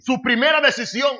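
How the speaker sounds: forceful pulpit declaration; emphatic preaching. Real, not acted. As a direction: proclaim it firmly to the congregation. Preacher hammering his point.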